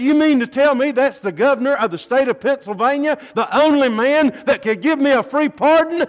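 high loud dismay; shocked realization. A narrator's reading. Young prisoner realizing he refused the governor, loud shocked disbelief. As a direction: cry out in disbelief at his mistake.

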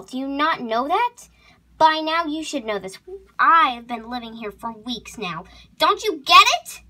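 angry scolding. Acted. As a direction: tear into an intruder. Horse scolds intruder in her stall.

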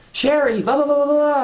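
very high, loud babbling imitation; mock whining coworker. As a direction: mimic a needy coworker shrieking for attention. Imitating Melissa bringing her problem over.

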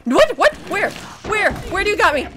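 high, loud panicked questions; real jumpy alarm. Real, not acted. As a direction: fire off startled questions at high pitch. Startled by game monster attack.

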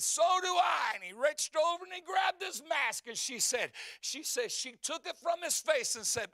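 loud, high, excited revival storytelling; full-force preaching. Acted. Preacher retelling the wife's testimony to the church, fervent. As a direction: drive the testimony home at a preacher's pitch.

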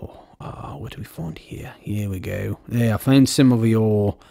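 put-on, halting British accent; bad British accent. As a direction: attempt British, badly faked. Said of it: "I still have a really bad British accent".

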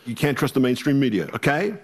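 a mild Trump send-up with a rising "okay?"; Donald Trump imitation. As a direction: mimic Trump driving the point home, then lift "okay?" upward. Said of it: "He says".

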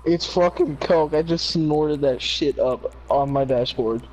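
usual speaking voice

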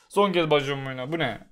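ordinary delivery

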